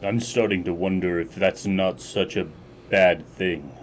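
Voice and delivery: lightly voiced tired captain, low and halting; weary old captain. Captain confiding doubts to a subordinate, weary and low.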